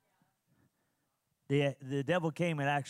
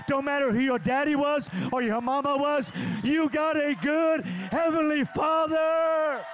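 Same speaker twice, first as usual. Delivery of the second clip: climactic preaching; 8 semitones higher than usual. Acted. Pastor rallies the congregation to a shouted climax.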